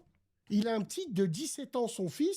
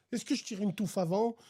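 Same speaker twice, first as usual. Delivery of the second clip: normal talking voice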